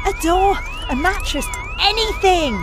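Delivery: urgent shout. Acted. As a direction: bark orders at onlookers. Ordering bystanders at rescue.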